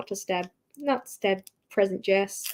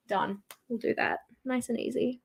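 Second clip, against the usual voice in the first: quiet; 8 dB quieter than usual. Real, not acted. Gentle finishing touch.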